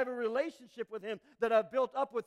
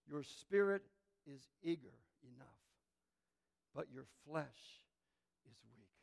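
hushed quote; 8 semitones lower, 8 dB quieter than usual. Acted. Preacher quotes Jesus, gentle.

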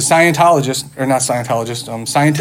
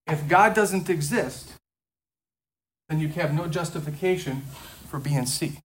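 much softer, slow, deliberate conclusion; soft, slow reread; 11 dB quieter than usual. Shown as a demonstration. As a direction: drop the volume and let it land slowly. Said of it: "Let's read it again".

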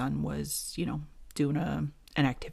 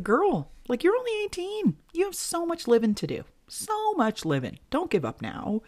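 put-on much higher, pleading with a young girl; high pleading girlfriend voice; 12 semitones higher than usual. Podcaster talks to the teen star, high and pleading. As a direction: beg her not to quit, voice up high. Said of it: "I was like".

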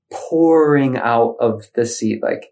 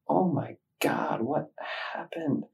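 awed whisper; 5 semitones higher, 10 dB quieter than usual. Retold or imitated. Man fallen at the shaman's feet, stunned whisper.

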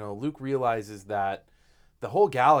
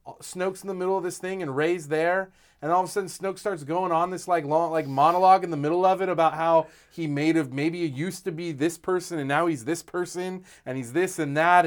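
excited retelling; 6 semitones higher, 5 dB louder than usual. Retold or imitated. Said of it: "he's like".

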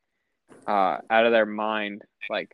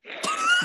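a sudden high squeal of a laugh; high shriek laugh. Retold or imitated. Stoned cartoon characters reacting, high squeal laugh.

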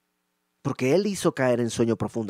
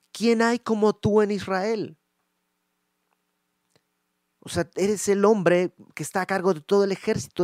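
loud, higher, accusing rebuke; 4 semitones higher, 6 dB louder than usual. Acted. David rebuking Abner for not guarding the king, loud. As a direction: scold him for failing the king.

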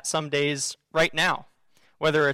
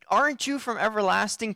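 higher-pitched, lightly incredulous question; challenging disbelief; 5 semitones higher than usual. Acted. Prophet questions God like a kid to parents, mildly incredulous. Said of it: "a kid saying to his parents".

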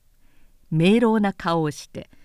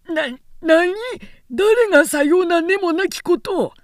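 much higher, louder, stammering shock; shocked outburst; 9 semitones higher, 6 dB louder than usual. Acted. The old retainer cornered by Harada's charge, high shocked cry. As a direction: blurt it out, thrown off balance. Namie caught off guard.

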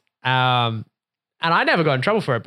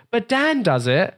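put-on higher, drawn-out protest of a child; kid's protest; 9 semitones higher, slower than usual. Friend tells on Dan to his dad, mimicked protest. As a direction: mimic a kid arguing with his dad, slow and high.

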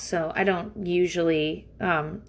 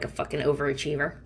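ordinary talk

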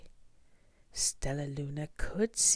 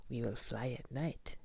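usual speaking voice

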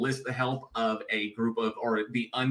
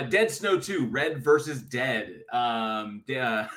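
ordinary talk

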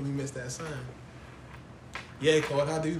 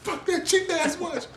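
higher, slower put-on voice; high slow mock gripe; 13 semitones higher, slower than usual. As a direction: sneer at the cheap watch. Acting frustrated gambler.

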